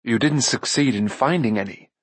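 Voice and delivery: usual speaking voice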